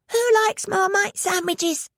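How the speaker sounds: put-on high, slow child voice; little boy. A narrator's reading. Ricky asking guests about sandwiches, high and slow. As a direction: ask it slowly like an eager kid.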